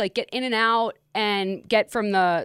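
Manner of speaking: normal talking voice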